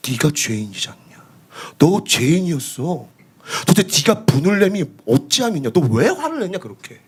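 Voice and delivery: a hint of God gently rebuking. God speaking at the cross.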